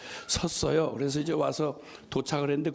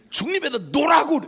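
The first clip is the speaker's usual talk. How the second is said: mock shout, much higher and louder; retold yell; 11 semitones higher, 7 dB louder, faster than usual. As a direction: yell the order again. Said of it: "막 화를 내면서". (중립에 놓으라고 외치는 흉내)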